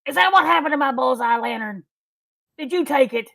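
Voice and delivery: high, loud indignant demand; accusing innkeeper. Acted. The man demands his stolen lantern back, high and loud. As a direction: confront the thief hard. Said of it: "stands up and says".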